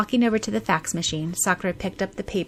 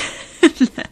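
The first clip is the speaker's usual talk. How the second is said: high, louder little laugh; short laugh; 9 semitones higher, 6 dB louder than usual. A narrator's reading. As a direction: give a quick acted laugh. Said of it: "Malin laughed".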